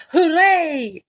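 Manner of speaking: a crowd's happy shout; villagers cheering. A narrator's reading. Villagers cheer the whale as the tide comes in. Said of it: "villagers shouting".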